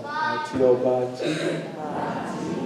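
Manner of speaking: very soft and very slow, drawn out; a soft, slow call for the crowd to echo. Shown as a demonstration. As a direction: breathe the call gently and slowly. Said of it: "Give me a soft one".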